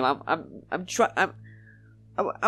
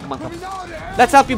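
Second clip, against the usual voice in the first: usual speaking voice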